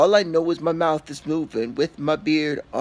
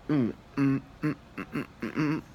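muffled humming; 4 semitones lower, 9 dB quieter, faster than usual. Real, not acted. Fingers in mouth humming.

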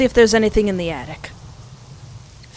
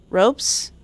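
ordinary talk

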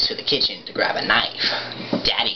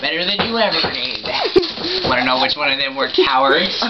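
Joker; 7 semitones higher than usual. Acted. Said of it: "do your, uh, Joker impression".

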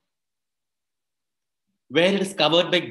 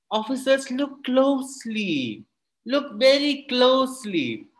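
"Look very closely" stretched slow and deliberate, pitched up; Kalam's own voice; 7 semitones higher, slower than usual. Acted. Kalam shows the officers the glass, slow and measured. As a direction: draw each word out slowly like a patient teacher. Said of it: "And in his own voice said".

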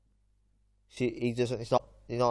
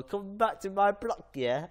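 high, slow put-on street voice; jokey fake gangster threat; mock tough talk; 8 semitones higher, slower than usual. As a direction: play a hammed-up roadman taunt. Retold street taunt, then denied.

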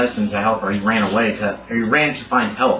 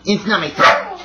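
higher-pitched mock pleading; voicing the dog; 6 semitones higher than usual. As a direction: lightly voice a put-upon pet. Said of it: "but she's like".

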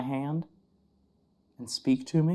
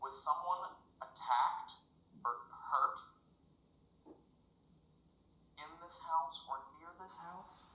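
softer question to the spirit; quiet question; 14 dB quieter than usual. Real, not acted. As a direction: ask it again, hushed.

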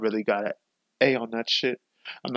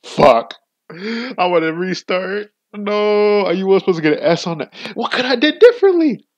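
loud, high, pitch-climbing rage at the game; frustrated outburst; 9 semitones higher, 8 dB louder than usual. Real, not acted. Gamer furious at missing the S rank, loud and rising. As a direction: yell your frustration at the screen.

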